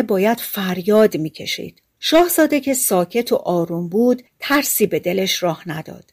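ordinary delivery, nothing marked

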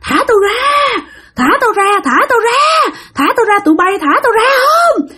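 high, frantic crying shriek; a tied woman howling between cries and a laugh; possessed screaming. Retold or imitated. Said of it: "chị ấy cứ gào".

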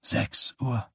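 tight, pressed voice; forced out through a tight throat; squeezed and tense, in trance; strained. A narrator's reading. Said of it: "sagte Johnny gepresst".

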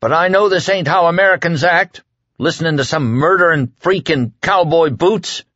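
desperate plea. A narrator's reading. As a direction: plead with rising panic.

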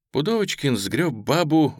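ordinary delivery, nothing marked

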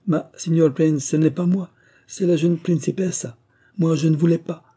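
high-pitched Italian servant's protest; protesting Italian gardener. A narrator's reading. Italian gardener denying it to the prince, protesting high.

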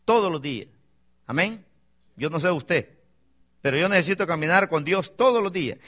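ordinary delivery

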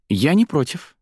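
eager, high-pitched exclamation; exclaiming. A narrator's reading. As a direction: jump in brightly, pitch leaping up. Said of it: "воскликнула девушка".

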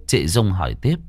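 usual speaking voice

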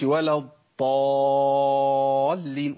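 الضالين with ṭāʾ for the ḍād, sung; ḍād made ṭāʾ. Shown as a demonstration. Teacher singing the wrong ṭāʾ form. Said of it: "ما بدلش الضاد بطاء".

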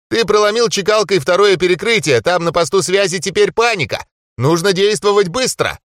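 a hint of a hurried elf. Elf voiced by narrator.

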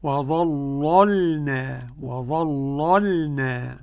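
"وظللنا" very slow, first ل dark, second light; thickened first lam. Shown as a demonstration. Teacher models it for the student, first ل of "وظللنا" thickened. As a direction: stretch out "وظللنا" with a velarized first ل. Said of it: "أغلظ اللام الأولى ورقق الثانية".

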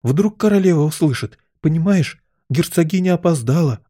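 high, anxious explanation; frightened. A narrator's reading. Rabbit fearing the Queen will hear, frightened. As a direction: explain nervously, afraid of being overheard. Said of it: "испугался кролик".

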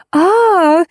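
shriek. A narrator's reading. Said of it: "завизжала Леночка".

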